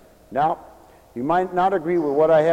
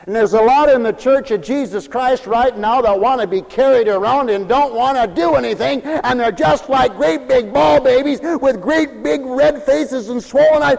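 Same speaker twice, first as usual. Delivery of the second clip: loud, high preaching at full force; fiery preaching; 8 semitones higher, 7 dB louder than usual. Acted. Preacher scolding lazy Christians in a loud fiery sermon. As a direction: drive it home to the congregation.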